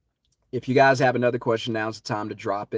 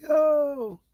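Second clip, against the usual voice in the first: much higher, rising calls; urgent yelling; 14 semitones higher, much slower than usual. Retold or imitated. Warning people on the beach to run, yelling. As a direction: shoo them off the beach. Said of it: "And I'm saying".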